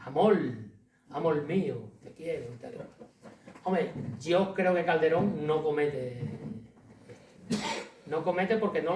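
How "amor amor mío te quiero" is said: "Amor" said as "amol", the Andalusian or Cuban R turned to L; "amor mío" with an Andalusian or Cuban L for the final R. Shown as a demonstration. Lecturer showing the Andalusian or Cuban R-to-L swap, Andalusian or Cuban lilt. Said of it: "como si fuera una L".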